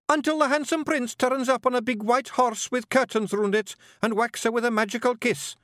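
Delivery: very high, loud, carried-away piping; wee Scottish storyteller. A narrator's reading. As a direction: gush the fairy tale as a tiny Scot. Voices Billy carried away.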